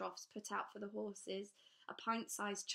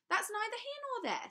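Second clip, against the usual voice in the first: much higher, loud dismissive crow; brushing off; 7 dB louder than usual. A narrator's reading. Trapwood brushes off the customs officer's son. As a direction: waves the child's question away.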